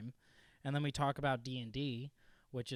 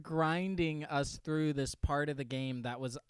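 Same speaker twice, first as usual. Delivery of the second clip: normal talking voice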